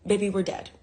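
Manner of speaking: normal talking voice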